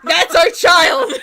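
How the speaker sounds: loud, slow laughing shout; proud shout. Retold or imitated. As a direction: cheer it proudly. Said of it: "I'm like".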